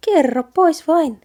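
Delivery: a small mouse gently prying; little mouse voice. A narrator's reading. Mouse coaxing the boy.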